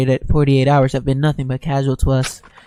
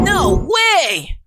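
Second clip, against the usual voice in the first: high defiant cry; defiant shout; 12 semitones higher than usual. Acted. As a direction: throw back a stubborn refusal. Art refuses loudly.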